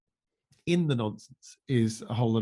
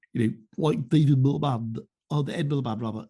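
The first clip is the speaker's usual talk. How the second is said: ordinary talk, nothing marked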